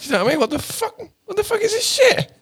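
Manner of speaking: high outraged squeal building; mock outrage. Eating sugary American bread, high outraged squeal. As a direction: build to a high disgusted yelp. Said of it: "you're like".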